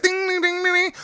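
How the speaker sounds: high, soft sing-song dings; bouncy ding noises. Retold or imitated. Comedian imitating the president's little bounce with high soft dings. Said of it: "He had that bounce like".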